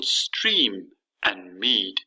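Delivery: "stream" and "mead" drawn out on the long ee; very slow "stream and mead", dwelling on the shared vowel; similar vowel sounds. Shown as a demonstration. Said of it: "they have got similar sounds".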